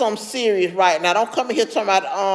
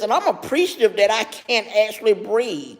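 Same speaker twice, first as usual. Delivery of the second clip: normal talking voice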